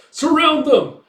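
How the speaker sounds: very high, loud battle order; shouted command. Retold or imitated. Enemy leader directs riders, shouted command. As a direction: yell orders across a field.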